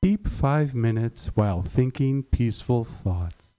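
low, slow, calming drone; very low, measured mock-serene voice; soothing tea-bag voice. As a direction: read it like a deep meditation guide. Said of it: "chamomile bag that literally says".

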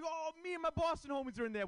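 mock self-quote. Comic retelling his boast to San Francisco comics. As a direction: play it up like you belong. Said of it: "I'm like".

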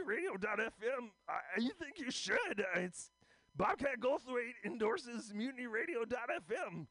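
high stammering comic screech, halting; Bobcat Goldthwait. Retold or imitated. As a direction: squeal it out, stuttering and straining. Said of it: "here's my impression of Bobcat Goldthwait".

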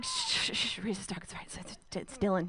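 whispered shushing to herself; soothing whisper to a nervous self; hushed. Retold or imitated. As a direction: hush yourself down, half-whispered. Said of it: "I was like".

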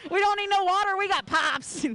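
high, loud mock country twang; country accent. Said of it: "in a country accent".

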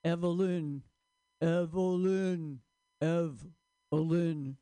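zoned-out slow repetition; stoned drawl. Pretend. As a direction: drift off, drawing the name out. Zoning out on mother's name.

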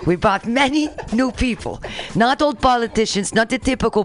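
deliberate foreign president in a fake Russian accent; bad Russian accent. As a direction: flatter the other leader in a faked Russian accent. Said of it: "how do I sound like a Russian".

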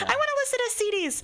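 put-on high little kid voice; little girl voice. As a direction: imitate a small child asking. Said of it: "My four, my four-year-old daughter".

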